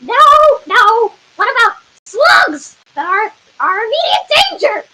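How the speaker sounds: high, climbing protest; exasperated objection. Acted. Council member corrects a guard, exasperated high protest.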